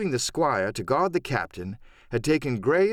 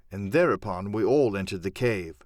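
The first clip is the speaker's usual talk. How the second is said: ordinary delivery, nothing marked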